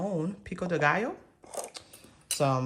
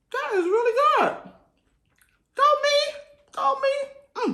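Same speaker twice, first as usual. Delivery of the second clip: much higher, louder squeal of joy; squealing delight; 7 dB louder, slower than usual. Real, not acted. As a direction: squeal with pride over the dish. Delighted by own cooking.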